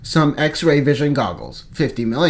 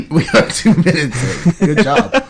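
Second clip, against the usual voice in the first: laughing; 2 semitones higher, 5 dB louder than usual. Real, not acted. Laughing at their slow start.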